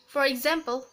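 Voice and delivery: ordinary talk, nothing marked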